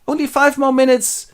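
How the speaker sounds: high, loud begging whine; childlike pleading. Acted. Scrooge begs to stay at the party, pleading. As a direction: beg in a high put-on voice.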